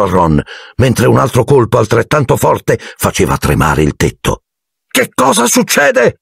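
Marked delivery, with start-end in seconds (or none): 4.9-6.2 very high, slow alarmed question; startled by the blows; scared high cry; frightened. A narrator's reading. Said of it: "Ron sussultò".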